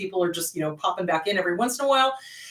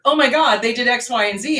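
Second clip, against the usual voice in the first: loud, shocked gasp of disbelief; shocked client; 6 dB louder than usual. Retold or imitated. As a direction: burst out in mock horror. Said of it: "a week or two later".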